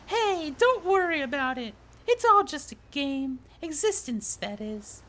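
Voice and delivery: high-pitched reassuring character voice; high put-on voice; easygoing game character. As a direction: pitch it high and soothe the newcomer.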